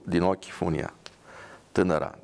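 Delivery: ordinary delivery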